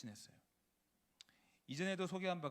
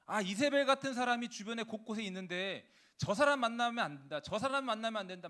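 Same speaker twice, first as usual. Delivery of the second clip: louder, higher, accusing warning; loud pointing judgment; 5 semitones higher, 8 dB louder than usual. Retold or imitated. Preacher voicing his own judging thoughts, a loud accusing warning. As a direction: wag a finger and call it out loud.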